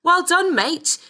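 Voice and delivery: high-pitched cheering call; crowd shout. A narrator's reading. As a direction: call it out like an onlooker applauding, pitch well up. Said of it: "shouting things like".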